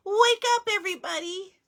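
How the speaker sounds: high, loud wake-up call; relieved shout. A narrator's reading. Milo calling everyone awake, high and loud. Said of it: "he shouted with relief".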